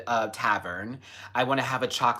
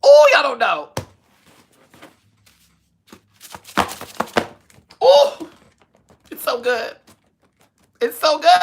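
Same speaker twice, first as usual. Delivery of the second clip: excited; 13 dB louder than usual. Real, not acted. Raving about cream cake.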